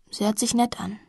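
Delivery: usual speaking voice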